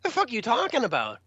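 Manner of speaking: high-pitched disbelieving retort; incredulous. Retold or imitated. Musician told to cross the crowd, incredulous. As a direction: throw the suggestion back in disbelief.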